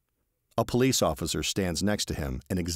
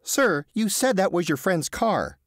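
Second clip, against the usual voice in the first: slow, pointed accusation; accusing; 14 semitones higher, 5 dB louder than usual. Acted. Receptionist catching Teddy in his lie, slow and accusing. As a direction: call him out on the lie. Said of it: "the receptionist says accusingly".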